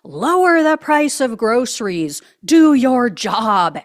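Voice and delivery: loud, demanding yell at the president; furious reader yelling Do your job; angry all-caps shout. Retold or imitated. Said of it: "he starts screaming at Trump".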